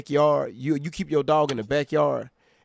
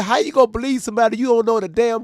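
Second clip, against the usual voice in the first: indignant; 8 semitones higher, 6 dB louder, faster than usual. Retold or imitated. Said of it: "I said".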